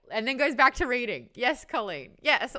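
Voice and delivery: ordinary talk, nothing marked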